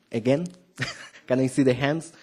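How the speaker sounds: ordinary talk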